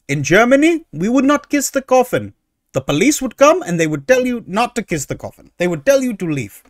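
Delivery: mock German accent that slips after the first syllable; lightly put-on German accent, quickly lost; terrible German accent. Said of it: "That was a terrible German accent".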